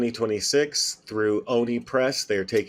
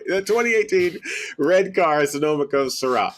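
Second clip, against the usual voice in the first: laughing; 7 semitones higher, 6 dB louder than usual. Real, not acted. Laughing while correcting wine name.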